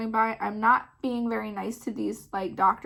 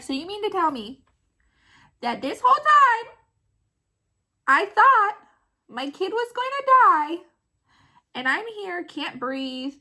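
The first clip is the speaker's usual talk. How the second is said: high, loud retold outrage; indignant disbelief; 6 semitones higher, 5 dB louder than usual. Mom confronting nurse over choking hazard, indignant. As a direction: climb to an incredulous peak, then ease slightly.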